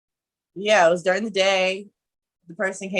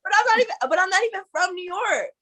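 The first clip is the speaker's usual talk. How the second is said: higher, insistent denial; defensive protest; 12 semitones higher, faster than usual. Real, not acted. Defending himself to the host, high insistent protest. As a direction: plead he's not from there.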